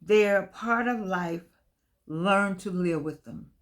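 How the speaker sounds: reading the book's words slowly and deliberately; slow, measured reading of a quoted line; slow read-aloud. Shown as a demonstration. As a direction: let each word land, unhurried. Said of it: "It says".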